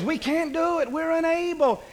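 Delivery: high-pitched mock complaint; defeatist majority. Preacher voicing the majority's report, defeatist complaint. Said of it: "The majority says".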